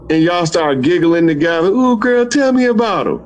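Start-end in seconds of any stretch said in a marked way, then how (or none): 1.7-3.2 put-on higher, gushing girl-talk voice; excited girlfriend. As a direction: coax a friend to spill about her new man. Sisters giggling, voicing girlfriend gossip.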